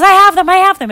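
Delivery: high, loud quick assurance; woman calling back to frantic parents, high and loud; urgent reassurance. Acted. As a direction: blurt out the good news.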